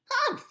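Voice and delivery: high, loud scornful humph; witch humph. Acted. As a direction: snort it like an offended witch. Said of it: "said the witch".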